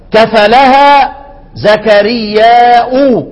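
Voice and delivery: slow Quranic reading with hamza; recited with hamza. Shown as a demonstration. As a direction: stretch زكرياء and close on the hamza. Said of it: "انتبه معايا في الموضع ده".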